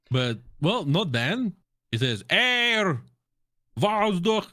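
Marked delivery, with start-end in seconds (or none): 2.3-4.5 imitated radio callout. Imitating chief's shouted radio line.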